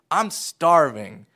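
mock child, loud and drawn out, "I'm starving"; whiny hungry child. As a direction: stretch it out like a hungry five-year-old. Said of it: "will come into my room and say".

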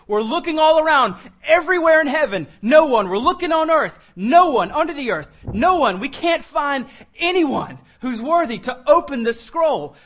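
fiery preacher, searching heaven; preaching at full force. Acted. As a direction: cry it out, no one anywhere.

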